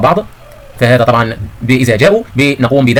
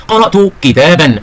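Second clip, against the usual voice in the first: slow, raised-pitch example sentence; model reading; 4 semitones higher, much slower than usual. Shown as a demonstration. Teacher reads the pupils an example, slow model reading. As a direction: read it out very slowly, word by word. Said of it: "نقرأ هذه الجمل".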